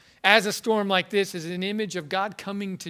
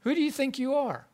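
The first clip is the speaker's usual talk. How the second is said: higher-pitched mock-indignant question; God's indignant challenge; 6 semitones higher, 4 dB quieter than usual. God rebuking Job for talking back, mildly acted indignation. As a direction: imitate an affronted challenge, pitch raised without shouting. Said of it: "He, he almost says".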